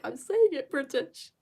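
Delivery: lightly exasperated retelling; protesting retort. Retold or imitated. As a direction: protest as if misunderstood. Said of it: "And I'm like".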